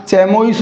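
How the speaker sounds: चैमोइस read as spelt with "ch"; candidate mispronunciation. Shown as a demonstration. Teacher quizzing students with a wrong option, चैमोइस starting on "ch". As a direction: say चैमोइस as "chai-mo-is". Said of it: "क्या होगा साथियों इसका correct pronunciation".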